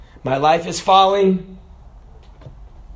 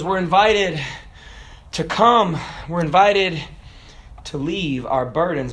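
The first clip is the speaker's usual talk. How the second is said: normal talking voice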